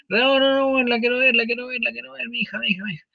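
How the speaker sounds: high, urgent repeated pleading; desperate father. Retold or imitated. A father insists to the nurse he must see his daughter, high and urgent. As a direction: insist over and over to see her.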